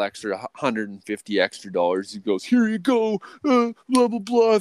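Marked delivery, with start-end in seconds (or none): 2.4-4.6 very high, loud, whiny blah-blah parody; mock excuses. Mocking the boss's excuses while handing over cash, whiny. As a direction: make the boss sound pathetic.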